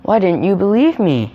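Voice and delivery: high, loud acted reproach; upset questioning. A narrator's reading. Upset boy asks the villagers.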